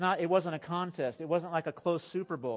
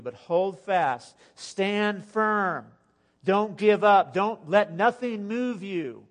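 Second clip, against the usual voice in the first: slow exhortation; 3 semitones higher, 6 dB louder, much slower than usual. Real, not acted. Preacher exhorting the congregation.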